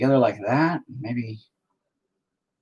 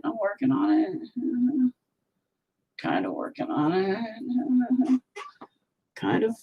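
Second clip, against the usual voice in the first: drawn-out joke voice; 13 semitones higher, 3 dB quieter, slower than usual. Pretend. Drawn-out put-on voice.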